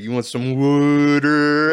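slow, high, loud "water" as WOODER; mocking Philly accent. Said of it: "they were like".

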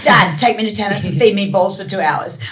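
lightly acted keen kid nagging; eager child pleading. Young teen waking dad for tennis, lightly acted pleading.

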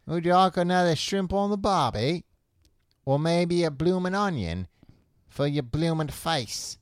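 fake Australian accent. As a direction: play the mock Australian waiter horsing around. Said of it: "They're doing fake accents".

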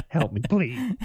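laughing through a mock plea for help. Real, not acted. Joking to his co-host about family life, laughing. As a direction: beg for help while cracking up.

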